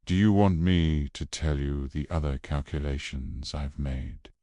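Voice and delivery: plain reading voice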